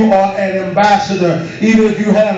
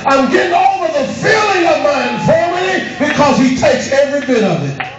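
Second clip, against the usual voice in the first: preaching at full force; 4 semitones higher than usual. Acted. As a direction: drive every phrase home. Preacher shouting again.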